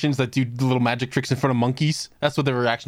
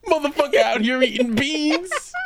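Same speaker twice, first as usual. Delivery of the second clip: laughing; 13 semitones higher than usual. Real, not acted. Laughing repeat.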